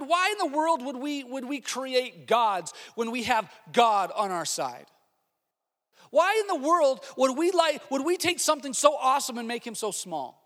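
loud, high, scolding Aaron as a fool; mock exasperated Aaron scolding the people; exasperated rebuke. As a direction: berate them like idiots, voice climbing. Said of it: "Don't you think that Aaron should have said".

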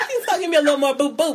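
high fast laughing talk. Real, not acted. Laughing fit.

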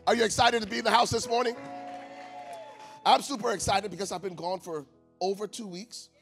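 normal talking voice

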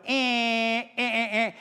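imitating cab horn blasts; honking horns. Preacher mimicking cab drivers honking at each other. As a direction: beep like an impatient cabbie. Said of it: "and they're going".

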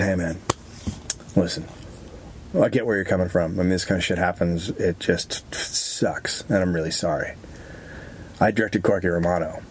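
lightly voiced, sheepish director owning up; low, sorry director. Director apologizing to the fired actor, low and sheepish. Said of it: "the director comes over and goes".

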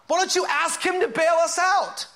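high, shouted demand; pleading captain's cry; exasperated captain. Acted. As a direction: challenge the sleeper urgently, at the top of the range. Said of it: "the captain comes out and goes".

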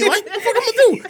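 high, laughing, what-can-I-do; laughing helplessness. Real, not acted. Laughing about a huge cousin, high and helpless. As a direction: throw up your hands while laughing.

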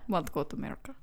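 put-on Russian accent. Voicing Pavlov's plea in a mock Russian accent. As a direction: murmur it in a heavy Russian accent. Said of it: "you do the Russian accent for what he says".

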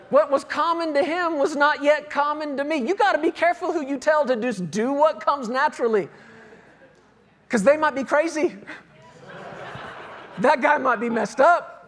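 usual speaking voice

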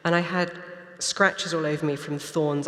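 ordinary talk

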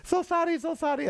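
high, badly faked Chinese-accented apology; mock Chinese accent. Comic showing the accent they claim they can't do, mock Chinese accent. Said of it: "I can't do an accent".

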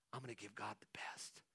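soft unvoiced whisper of a faithful son; whispered devotion. Abel whispering his promise, a hushed vow. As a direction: breathe it like a private vow.

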